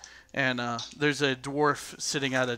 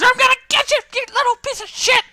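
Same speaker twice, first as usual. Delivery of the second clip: very high, screamed threat; shrieking acted rage; furious boy; 13 dB louder, faster than usual. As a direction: scream it while lunging at an enemy. Boy lunges, clawing at dwarf.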